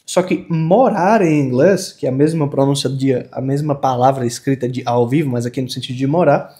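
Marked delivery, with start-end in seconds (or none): none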